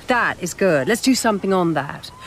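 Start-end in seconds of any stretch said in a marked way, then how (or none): none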